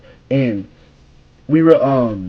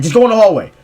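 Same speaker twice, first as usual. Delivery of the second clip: louder, higher imitated teacher's order; mock stern order; 7 semitones higher, 6 dB louder, faster than usual. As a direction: send the kid out, lightly hammed up.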